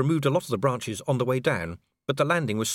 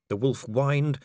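usual speaking voice

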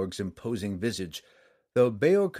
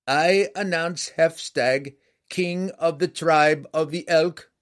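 loud, high, slow proclamation; clear herald's cry; 7 semitones higher, 7 dB louder, slower than usual. A narrator's reading. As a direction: cry out the king's arrival to the hall. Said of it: "cried out in a clear voice".

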